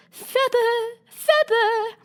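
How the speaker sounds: high repeated cry of an odd sheep; sheep cries again. Acted. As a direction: call it out twice, high and sharp. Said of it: "the sheep cried again".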